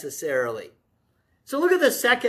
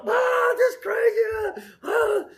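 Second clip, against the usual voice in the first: high, loud, slow frazzled cry of ah; frantic outcry; 13 semitones higher, 3 dB louder, slower than usual. Retold or imitated. The preacher acting his own panic for the congregation, high frazzled shout. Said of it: "when I'm going".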